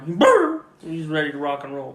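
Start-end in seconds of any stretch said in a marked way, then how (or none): 0.1-0.6 high, loud burst of barking; one sudden rolled bark; imitated guard-dog bark. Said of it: "Someone knocks on the door".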